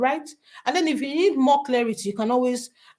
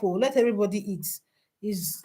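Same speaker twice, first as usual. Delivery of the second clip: ordinary talk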